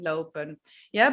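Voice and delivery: normal talking voice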